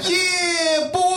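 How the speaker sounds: high, drawn-out hype-man holler. Acted. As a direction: wail it like a rap hype man. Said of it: "you look like [name]".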